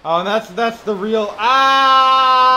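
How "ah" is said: long, high, very loud "aah" scream; sustained yell at full volume. Real, not acted. Yelling over rocket launch audio.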